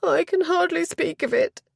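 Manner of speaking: high, distressed lady; barely able to bring it out; a high, troubled confession of distress; a hint of a groan. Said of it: "A groan escaped Miss Poynton".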